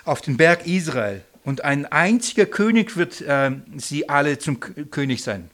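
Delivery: a loud, solemn Bible reading; scripture reading. Acted. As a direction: proclaim the verse to the room. Reads scripture aloud.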